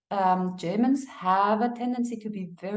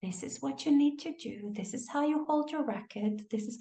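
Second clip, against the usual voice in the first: imitating a sweet trainer; 2 semitones higher, 6 dB quieter than usual. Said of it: "extra nice and extra sweet".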